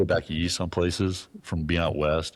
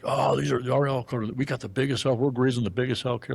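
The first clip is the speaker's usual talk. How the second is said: boastful higher mock voice; bragging local; 7 semitones higher than usual. Kentucky booth guy bragging about his elk, mock boastful. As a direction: puff up like a proud local showing off his elk. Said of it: "this guy's like".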